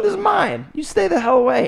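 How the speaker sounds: high-pitched mock possessive kid; guard your plate like a greedy little kid. Boy at family dinner refusing to share, high mock possessive. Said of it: "I'm like".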